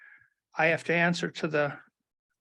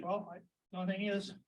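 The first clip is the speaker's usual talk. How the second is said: distant, off-mic speech; talking away from a dead mic; microphone off; 3 semitones higher, 12 dB quieter, faster than usual. Real, not acted. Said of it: "Microphone on".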